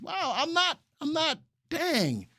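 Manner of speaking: high, caught-off-guard wow; surprised. Real, not acted. Host to chat, taken aback by Doug's remark. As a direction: sputter in mock disbelief. Stunned by viewer comment.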